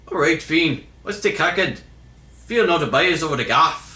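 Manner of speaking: high, brisk mock Irish accent. Shown as a demonstration. Vlogger retrying a viewer's misspelled line, attempting an Irish accent. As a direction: have another go at a put-on Irish accent. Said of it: "meant to sound like an Irish accent".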